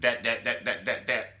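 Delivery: excited stammer. Real, not acted. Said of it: "She got me where I lived".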